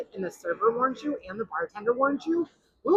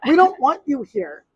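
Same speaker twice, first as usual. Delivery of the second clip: triumphant retort; 5 semitones higher, 8 dB louder than usual. Retold or imitated. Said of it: "she's like".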